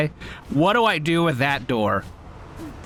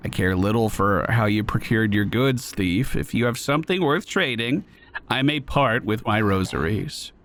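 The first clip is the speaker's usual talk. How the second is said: lower, curt and aloof; lightly lowered stern voice; cold warrior voice; 7 semitones lower than usual. Acted. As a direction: keep the thief at arm's length.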